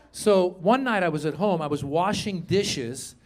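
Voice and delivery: normal talking voice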